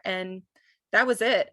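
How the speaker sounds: usual speaking voice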